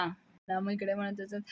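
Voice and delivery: ordinary delivery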